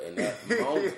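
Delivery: laughing through the words; real laugh breaking in. Real, not acted. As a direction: crack up mid-sentence. Laughing at his own story.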